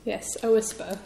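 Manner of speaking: ordinary talk, nothing marked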